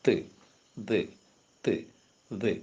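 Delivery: "ത്" with tongue tip against upper teeth; short, isolated "ത്" sounds, tongue touching the top teeth; dental sounds. Shown as a demonstration. Teacher giving examples of dental sounds, "ത്" sounded alone with tongue on teeth. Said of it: "tip of the tongue against the upper teeth".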